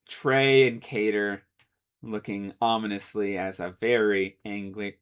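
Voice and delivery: usual speaking voice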